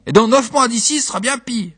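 high, insistent warning; protesting wife. Acted. Wife warns her husband.

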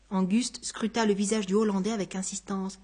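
ordinary delivery

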